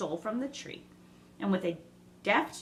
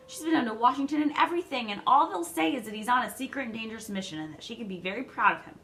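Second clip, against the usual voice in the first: upset pleading; 5 semitones higher, 5 dB louder than usual. A narrator's reading. Meg upset about father.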